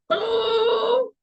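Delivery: long high-pitched "ooh"; mock-astonished, drawn-out high exclamation. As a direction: let out a high, sustained oh. Acting out viewers' astonished reaction.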